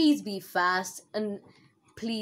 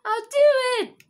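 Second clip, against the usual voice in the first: excited; 10 dB louder, faster than usual. Real, not acted. Hyped before a stunt jump.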